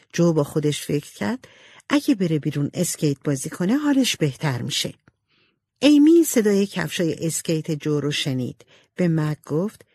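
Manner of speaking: usual speaking voice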